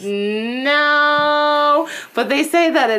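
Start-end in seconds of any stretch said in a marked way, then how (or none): 0.0-1.9 high, long-held no; imitated drawn-out denial. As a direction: stretch the denial out, pitched high. Quoting roommate's drawn-out denial.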